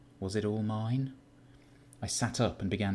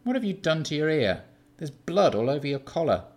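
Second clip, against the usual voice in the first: higher, louder sudden concern; concerned Keith; 7 semitones higher, 6 dB louder than usual. Acted. Keith spots blood on Sean's collar, suddenly concerned. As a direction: startle at the blood.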